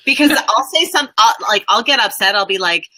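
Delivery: usual speaking voice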